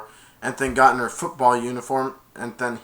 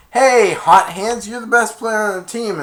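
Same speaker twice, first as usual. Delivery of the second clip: big shouted mocking call across the field; loud taunt; 8 semitones higher, 8 dB louder than usual. Spike jeering at a teammate, loud mocking shout. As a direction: yell it at him to rattle him.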